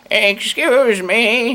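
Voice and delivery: put-on high, slow, creaky old lady. Very old lady asking the clerk for help, high and slow. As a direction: pitch it up like a frail old woman.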